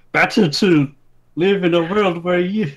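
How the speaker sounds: lightly voiced angry brawler.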